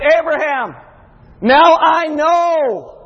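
God declaring aloud; God's proclamation. Acted. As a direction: proclaim it slowly from on high. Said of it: "God said".

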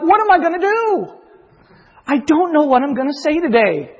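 high, rushed, panicky; fretting aloud on Saturday morning; panicked self-talk. Retold or imitated. Preacher panicking the morning before his sermon, high and anxious. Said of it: "I'm like".

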